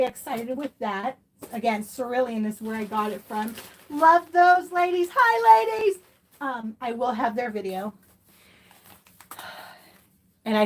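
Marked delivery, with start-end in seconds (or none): 3.9-6.0 high, loud, sing-song hello to friends; sweet high-pitched shout-out; gushing greeting. Real, not acted. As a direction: wave hi to the ladies with a squeal.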